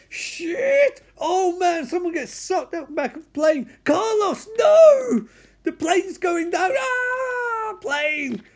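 very high, loud frantic wailing; frantic screaming. Retold or imitated. Spike screaming as the plane goes down, high frantic wail.

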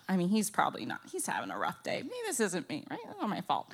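normal talking voice

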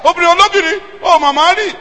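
angry shout at the woman; angry Yoruba shout. Retold or imitated. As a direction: rebuke her sharply. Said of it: "I was shouting at her".

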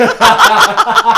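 high, loud cackling laugh; loud, high peal of laughter; real laughing fit. Real, not acted. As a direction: burst out laughing at your own joke. Laughing at own joke.

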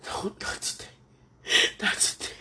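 breathy pleading whisper; barely voiced begging; tearful whisper. Real, not acted. Whispered plea while crying.

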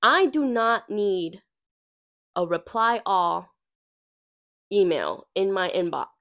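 normal talking voice

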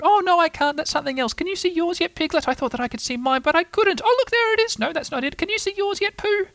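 very high, chattering little voice; excitable Roo. Acted. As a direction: chatter eagerly in a tiny high voice.

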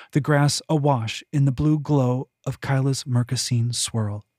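ordinary delivery

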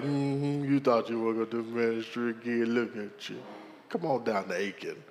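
low, hushed teasing God voice; voicing the Lord. God teasing the pastor, low and hushed. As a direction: tease him in a low, knowing voice. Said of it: "The Lord's like".

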